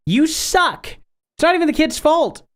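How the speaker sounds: high-pitched exasperated telling-off; indignant mock scolding. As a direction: scold a useless parent, voice way up. Mock scolding of a negligent parent.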